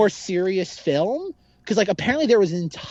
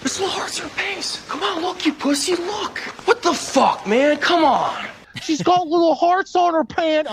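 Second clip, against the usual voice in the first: Stifler impression; 12 semitones higher than usual. Host re-enacting Stifler urging friend to look, high excited shout. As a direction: shout it like a frat boy who can't believe his eyes. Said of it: "laughing at the way he's delivering the line".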